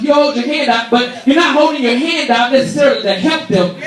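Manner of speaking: ordinary talk, nothing marked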